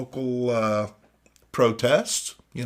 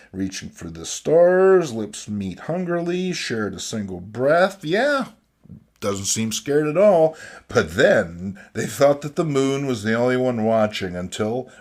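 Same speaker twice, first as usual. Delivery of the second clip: usual speaking voice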